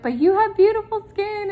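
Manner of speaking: high, loud, excited praise; gushing compliment. Retold or imitated. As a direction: gush over her, all enthusiasm. Retelling her own compliment.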